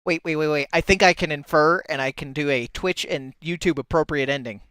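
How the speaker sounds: usual speaking voice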